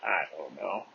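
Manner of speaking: low, soft, noncommittal "I don't know"; low mumble. Real, not acted. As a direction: let it drop low and quiet. Same words, low then high.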